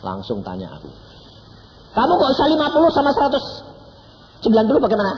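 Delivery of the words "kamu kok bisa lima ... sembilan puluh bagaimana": high, loud, fast disbelief; quick shocked questioning; astonished question. Acted. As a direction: fire the question in amazement.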